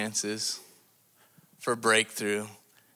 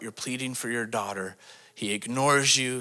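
ordinary delivery

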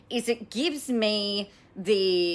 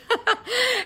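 high laugh. Real, not acted. Laughs at own pun.

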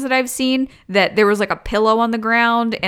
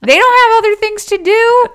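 incredulous; 12 semitones higher, 6 dB louder than usual. Real, not acted. Mocking the demon lineup.

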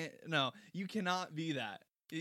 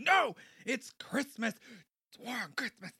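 high indignant yell, fading down; mock outburst; 8 semitones higher, 4 dB louder than usual. Mom refusing to turn down the song, indignant yell. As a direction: shout it like an offended mom.

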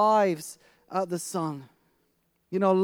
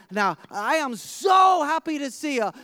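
excited; 4 semitones higher than usual. Retold or imitated. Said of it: "I'm thinking".

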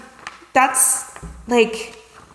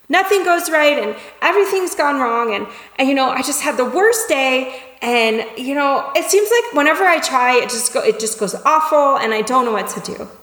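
higher-pitched, upset venting; acted overwhelmed; 6 semitones higher than usual. Someone comes to vent about the worst day, acted overwhelmed. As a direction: pile up the woes in a mock fluster. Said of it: "overwhelmed and, and upset right now".

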